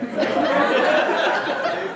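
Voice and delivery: high, loud burst of laughter; big amused laugh. Real, not acted. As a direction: laugh out at the funny bit. Laughing about banana rats.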